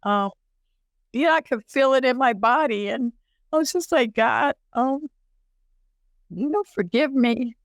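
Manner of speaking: high, unsteady voice holding back tears; tearful. Real, not acted. Woman recalling her prayer in pain, tearful. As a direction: push on through the tears. Composing herself after tearing up.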